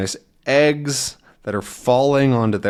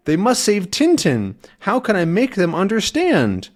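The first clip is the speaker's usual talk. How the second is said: anxious dog; 8 semitones higher than usual. Acted. Snowy's thoughts voiced.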